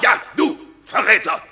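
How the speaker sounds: geschrien, viel höher und lauter; wütender Schrei. Acted. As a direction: schleudere ihm das Wort hasserfüllt ins Gesicht. Wortgefecht, Anklage wird wiederholt. (Ankläger schreit den Beschuldigten wütend an)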